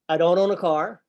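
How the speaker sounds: ordinary talk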